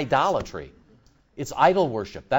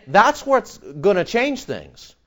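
ordinary delivery

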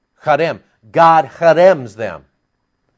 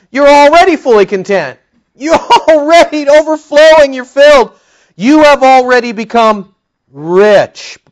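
much higher, louder mocking taunt; sarcastic; 9 semitones higher, 7 dB louder than usual. Paul mocking the proud Corinthians, high sarcastic taunt. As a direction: overdo the praise to mock them. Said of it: "So sarcasm".

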